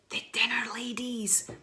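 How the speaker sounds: slow, hushed dread; ominous hush. Pretend. Author naming what inspired the books, ominous hush. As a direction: breathe it out slowly like a scary name.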